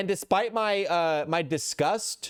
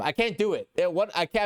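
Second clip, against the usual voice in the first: ordinary delivery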